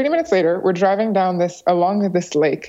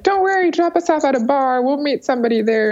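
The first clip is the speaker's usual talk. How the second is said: carefree reassurance; 7 semitones higher than usual. Retold or imitated. Brushing off the driver's worry.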